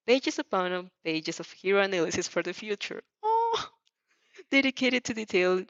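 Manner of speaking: ordinary delivery, nothing marked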